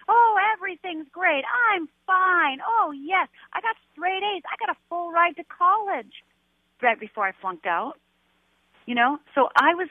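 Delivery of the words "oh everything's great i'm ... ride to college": high, bright put-on cheer, everything's fine; sing-song mask of being fine; fake cheerful self. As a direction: pretend all is great in a chirpy higher voice. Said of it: "I was a great fake".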